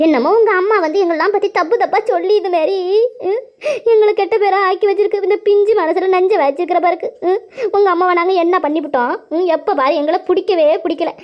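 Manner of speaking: high, plaintive complaint; aggrieved wailing. Acted. Aunt complaining to Geetha that her mother badmouths them, wailing. Acted grievance, announced as an act.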